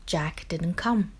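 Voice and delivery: usual speaking voice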